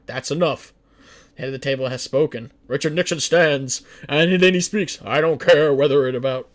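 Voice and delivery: loud, firm presidential command. Acted. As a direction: slam the table and take charge. Nixon commanding the cabinet.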